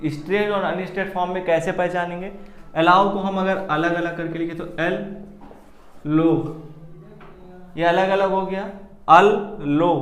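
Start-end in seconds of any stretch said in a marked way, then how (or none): 6.1-9.9 लो said louder with a push; teacher stressing the second syllable; stressed syllable demo. Shown as a demonstration. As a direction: lean on लो in अल लो. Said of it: "लाउ हमने जोर देके बोला".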